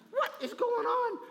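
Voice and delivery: high, bewildered question; a king baffled by silent advisors; puzzled king. Acted. King asking his silent advisors, high and puzzled.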